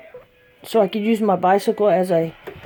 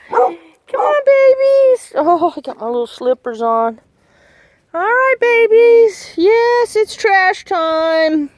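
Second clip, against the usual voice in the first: much higher, cooing voice for the dogs; doggy talk; 11 semitones higher, 5 dB louder, slower than usual. Acted. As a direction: coo at the dogs like babies. Calling animals.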